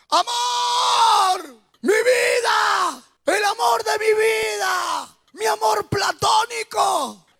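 shouting from the street. Retold or imitated. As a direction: call up to her window like a man possessed. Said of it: "Comenzó a gritar desde la calle".

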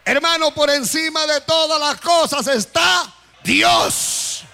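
normal talking voice

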